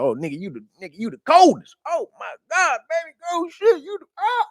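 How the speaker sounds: high mock swooning over his reflection, rising into a cry; mock vain Narcissus. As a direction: gush at your own reflection in a squealing high voice. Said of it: "He like".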